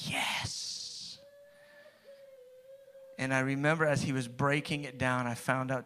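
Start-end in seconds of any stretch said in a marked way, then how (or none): 0.1-1.2 breathless whispered yes. Retold or imitated. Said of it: "I remember saying out of my mouth".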